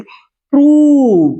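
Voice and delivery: प्रूव high, loud and very long; drawn-out model word. Shown as a demonstration. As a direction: stretch the oo in प्रूव. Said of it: "इसे कहते हैं". (प्रूव को ऊँचा और खींचकर)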